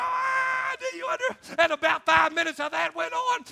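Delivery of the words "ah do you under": very high imitated scream; screaming drill instructor. Drill instructor screaming at a weeping recruit, high imitation. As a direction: shriek it and break off mid-demand.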